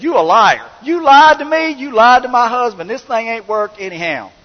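loud, high, furious accusations; angry accusing. Retold or imitated. Wife yelling at the lying seller, loud and angry. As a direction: shout it at the seller who lied. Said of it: "She got on Facebook and said".

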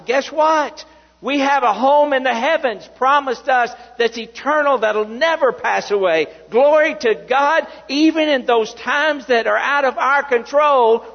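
loud, rising revival preaching; preaching at full force. Acted. Pastor exhorting the church about God's promise, loud full-force preaching. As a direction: preach it out big to the whole congregation.

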